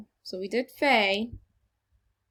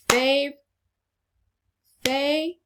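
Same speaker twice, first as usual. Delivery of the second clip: "favorite" in separate beats, higher, each syllable held apart; 4 semitones higher, 5 dB louder than usual. Shown as a demonstration. Teacher clapping out "favorite" with the class, syllable by syllable. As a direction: clap out "favorite" with a pause between syllables. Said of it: "syllable by syllable. Clap it with me".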